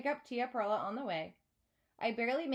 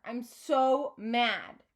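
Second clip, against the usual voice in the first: plain reading voice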